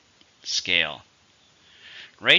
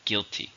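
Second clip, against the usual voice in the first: GUIL-ty, first syllable strong; "Guilty" stressed at the start; 4 dB quieter than usual. Shown as a demonstration. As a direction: model "Guilty" with weight on GUIL. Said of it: "Stress in the first".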